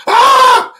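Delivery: sudden high startled "Ah!"; startled yell. Retold or imitated. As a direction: jolt awake with a yelp. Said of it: "and we both went".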